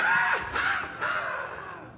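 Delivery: high, airy mock scream; imitated scream. Preacher imitating the boy shouting at the back, mock screaming. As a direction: mimic a disruptive screamer in bursts. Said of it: "él gritaba y decía".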